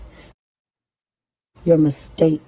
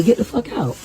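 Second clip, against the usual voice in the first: ordinary talk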